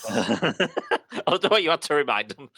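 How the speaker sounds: laughing through the words. Real, not acted. Game master laughs at the player's joke, talking through laughter. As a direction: speak while laughing out loud.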